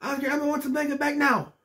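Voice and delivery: high squeaky Elmo, pleading loudly; Elmo yelling. Retold or imitated. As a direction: demand it back in a high Elmo voice. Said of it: "He's yelling into the thing".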